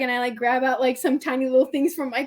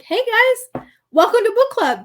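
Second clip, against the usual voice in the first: bright, high welcome to the visitors; perky hostess hello; cheery greeting; 8 semitones higher, 5 dB louder than usual. Retold or imitated. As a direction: greet them like old friends, pitched up.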